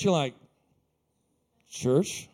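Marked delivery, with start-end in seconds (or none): 1.7-2.3 low, doubtful rising question; unimpressed one-word echo; skeptical query. Acted. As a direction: question it warily, pitched down. Invitee reacting doubtfully to invitation.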